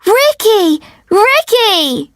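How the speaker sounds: high-pitched fan's call; high, excited cry for a star; excited crowd shout. A narrator's reading. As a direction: cheer the name like an adoring crowd. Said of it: "Everyone starts to shout".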